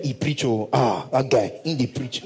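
dismissive Nigerian Pidgin answer; careless churchgoer. Retold or imitated. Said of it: "What did you learn".

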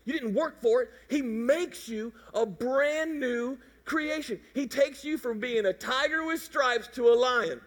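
ordinary talk

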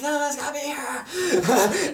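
high, drawn-out mock panic; frantic retelling. Searcher insisting the lost shoes must be here, frantic. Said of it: "like, frantically".

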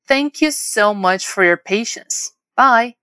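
very slow, connected "Thank you so much for your patience", then "Bye"; whole model phrase. Shown as a demonstration. Teacher modelling thanks and goodbye, slow and connected. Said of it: "Vamos repetir mais uma vez?".

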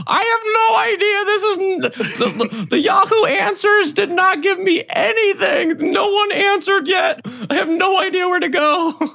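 very high, loud, hammed-up wail; frantic droid navigator. Mira telling Sybil they are lost, high frantic wail. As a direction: shout helplessly in a high-pitched character voice.

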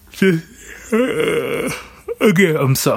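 talking through a yawn; words stretched by a yawn. Real, not acted. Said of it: "I'm so sorry for the yawning".

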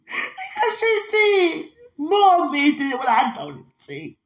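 high, slow crying speech; high wail of overwhelmed feeling; tearful outpouring. Real, not acted. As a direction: push slow words through real tears. Overcome while explaining her gratitude.

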